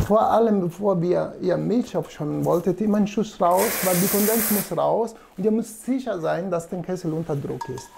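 ordinary delivery

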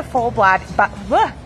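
stammered false starts ending in a blurted "bleh"; real fumble on "bod-, bo-, bleh"; tongue-tied stumble. Real, not acted. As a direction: trip over "bod-", give up with "bleh". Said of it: "Easy for you to say".

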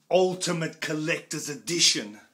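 big, proud title call, high and loud; grand announcement. Real, not acted. Fan showing off his new box set, booming the title.

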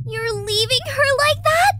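high, loud shocked question; appalled disbelief. Acted. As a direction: cry out in disbelief. Appalled at leaving the body.

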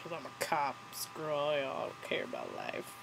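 lightly voiced drunk man, low and quiet; mumbled, don't-care drunk impression; drunk Charlie. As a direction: imitate a tipsy speech, trailing away softly. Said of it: "I think he was drunk by then".

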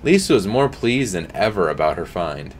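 usual speaking voice

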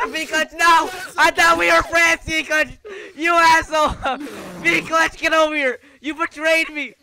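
loud, high, melodramatic accusation; hammed-up outraged betrayal; betrayed outcry. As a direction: yell at a friend who turned on you. Betrayed by friend in game.

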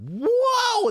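loud, high, drawn-out whoa; soaring cry of astonishment; amazed exclamation. Real, not acted. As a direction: react big to an outrageous opinion. Exclaims at a viewer's hot take.

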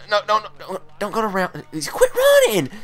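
rising to a loud, high-pitched complaint; frantic squeal. Real, not acted. Gamer yelling at fleeing opponent, loud high squeal. Opponent keeps running away.

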